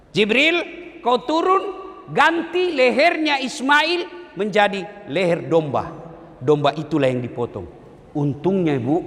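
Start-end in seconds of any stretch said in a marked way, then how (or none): 0.1-5.9 a commanding voice, somewhat higher and slower; divine command. Acted. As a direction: order Jibril down with authority. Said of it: "Lalu Allah perintahkan". (perintah Allah kepada Jibril dengan nada memerintah, agak tinggi)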